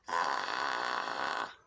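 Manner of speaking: deep crackling fry on ah; vocal fry. Shown as a demonstration. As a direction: let the ah crackle low and loose. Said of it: "maybe I should try that".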